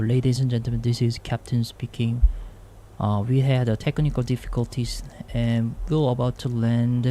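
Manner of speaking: slow, calm cockpit announcement in English; captain PA announcement. Shown as a demonstration. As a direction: read it like a pilot on the intercom. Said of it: "이렇게 하면서 뭐 어쩌고저쩌고 영어로 얘기하면 되겠죠". (기장 기내 방송)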